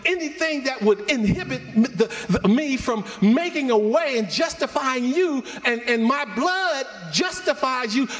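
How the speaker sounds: preacher voicing Jesus, building to a high shout. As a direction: let Jesus' voice swell to a shout. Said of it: "I can just hear him say it".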